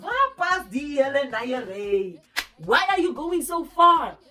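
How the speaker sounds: loud, high, drawn-out acted exasperation; exasperated protest. Girlfriend replaying her comeback to boyfriend, exasperated. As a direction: protest slowly and loudly, as if he has overstepped.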